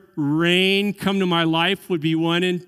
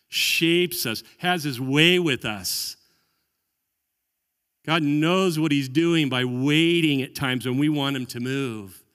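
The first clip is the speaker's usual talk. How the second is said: ordinary talk, nothing marked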